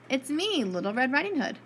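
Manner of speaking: usual speaking voice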